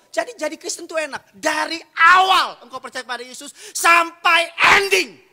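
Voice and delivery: high, sustained sermon shout; loud, driving revival preaching; full-force preaching. Real, not acted. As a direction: build point upon point at the top of the voice.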